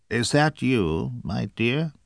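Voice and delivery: faint croaky disguised voice; croaking wolf as grandma. Acted. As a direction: croak it weakly, pretending to be grandma. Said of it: "croaked a faint voice".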